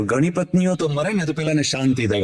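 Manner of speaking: ordinary delivery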